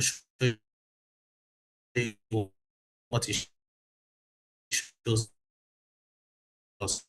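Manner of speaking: choppy breaking-up call audio; bad connection, words cutting out; glitchy robotic call. Real, not acted. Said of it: "your audio is breaking".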